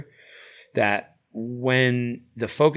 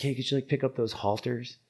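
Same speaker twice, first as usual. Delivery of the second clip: polite request; 5 semitones higher than usual. Retold or imitated. Polite request retold in story.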